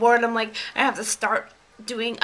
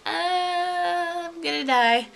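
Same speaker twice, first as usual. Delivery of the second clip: inner dread; 7 semitones higher, slower than usual. Pretend. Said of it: "In the back of my head, I'm gonna be like".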